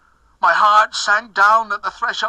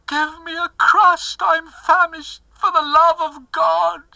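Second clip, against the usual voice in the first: a starving woman crying out for food, voice breaking; groaning plea; 10 semitones higher than usual. A narrator's reading. Dying wife begs her husband for a crust, high and crying. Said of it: "she groaned".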